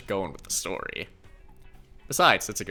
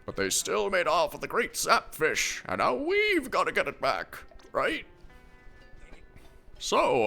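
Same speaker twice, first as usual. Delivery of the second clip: lightly voiced old man, raised pitch; 7 semitones higher than usual. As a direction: play a cheery old captain. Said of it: "you need to change your pitch drastically".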